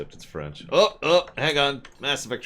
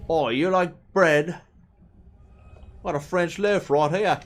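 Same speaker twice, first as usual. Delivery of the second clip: French chef; 11 semitones higher, 6 dB louder than usual. Pretend. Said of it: "another very French saying".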